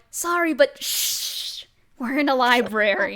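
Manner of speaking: a hint of a fussy librarian, shushing; librarian shushing whisper. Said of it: "Phil's gonna go like".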